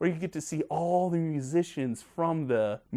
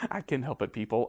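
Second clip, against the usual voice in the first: ordinary talk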